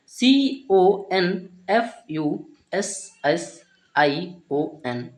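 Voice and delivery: slow spelling, each letter set apart; deliberate classroom spelling with gaps between letters. Shown as a demonstration. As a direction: spell it out one letter at a time. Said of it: "एक एक letter का उच्चारण".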